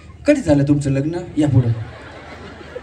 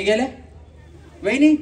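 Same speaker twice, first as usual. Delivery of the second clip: usual speaking voice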